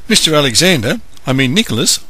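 eager correction. Acted. Nathan warming to friend.

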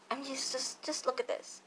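dropped to a near-hush, in awe. Real, not acted. Vlogger marveling at her palette to viewers, hushed awe. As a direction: hush as if admiring something precious. Gushing over palette on camera.

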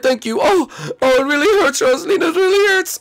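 ordinary talk, nothing marked

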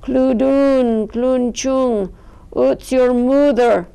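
mock rough ogress voice. A narrator's reading. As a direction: growl it like a clumsy ogress. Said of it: "Her voice was low and rough".

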